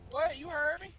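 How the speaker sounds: taunting. Real, not acted. Taunting rival over game.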